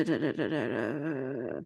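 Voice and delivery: ordinary talk, nothing marked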